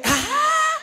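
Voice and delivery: hammed-up high cry of delight; excited shriek. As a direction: yelp with glee. Excited cry in retold scene.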